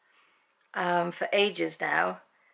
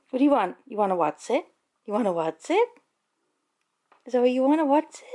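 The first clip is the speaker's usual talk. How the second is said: pet talk; 5 semitones higher, 5 dB louder, faster than usual. Real, not acted. Offering the dog a snack.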